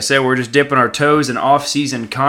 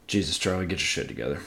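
muttered much softer and lower; self-scolding; 7 semitones lower, 12 dB quieter, much faster than usual. Real, not acted. Scolding himself for the bad impressions, low muttered. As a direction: grumble at yourself under your breath. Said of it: "scolding himself".

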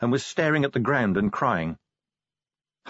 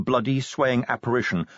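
plain reading voice

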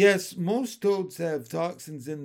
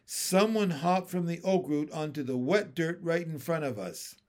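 plain reading voice

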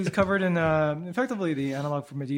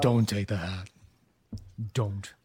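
low, eerie voice of warning; ominous warning; 5 semitones lower than usual. Acted. Unseen voice warning player off the hat, ominous. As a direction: warn them off, low and deliberate.

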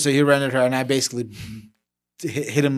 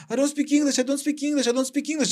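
much higher, panicky repeated plea; scared kid pleading; 11 semitones higher, 4 dB quieter than usual. Retold or imitated. Ten-year-old to bystanders after car hit, scared plea. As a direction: play a frightened boy who can't speak English. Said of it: "And I'm like".

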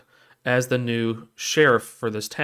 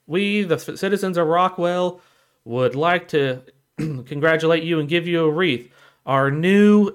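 higher, ceremonial read-aloud voice; town greeter; 5 semitones higher, 4 dB louder than usual. Retold or imitated. Town greeter reading a welcome to the new sheriff, proclaiming. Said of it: "town greeter starts reading off this".

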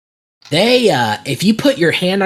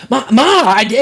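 high, louder whine to a mother, imitated; pleading kid; 8 semitones higher, 5 dB louder than usual. Kid interrupting his accusing mother, mock pleading.

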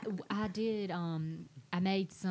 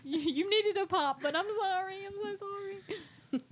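high, mock-crying sorry to the child; hammed-up tearful apology; 13 semitones higher than usual. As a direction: overplay the guilty sob, half joking.

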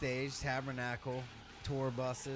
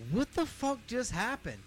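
stunned disbelief; 9 semitones higher than usual. Retold or imitated. Stunned in the parking lot.

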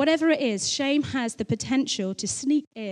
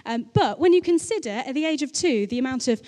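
ordinary talk

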